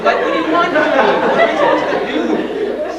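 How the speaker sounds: very high, loud wailing plea; mock tearful desperate screech; hammed-up crying. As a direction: wail helplessly at the doctor, comically overdone. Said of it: "So I'm crying now".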